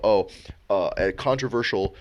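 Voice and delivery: ordinary talk, nothing marked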